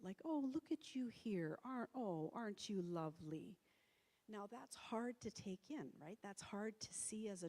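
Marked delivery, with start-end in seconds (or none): 0.2-3.6 imitated tender admiration, pitched up; imitating fond cooing. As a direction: coo as if to a pet, imitating mom. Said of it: "sort of stroking it and talking to it".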